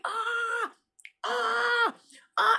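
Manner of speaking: high drawn-out cries of pain; retold scream. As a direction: mimic the man's startled scream. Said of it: "And he screamed".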